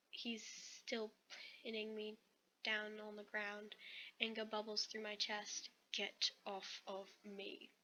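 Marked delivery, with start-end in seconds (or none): 5.9-7.7 "Get off of me" slowly, each word set apart; every word emphasized. A narrator's reading. Narrator's character makes the point clear, every word of "Get off of me" stressed. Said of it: "I emphasize every word".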